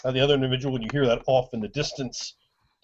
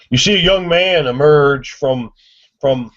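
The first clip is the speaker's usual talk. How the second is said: normal talking voice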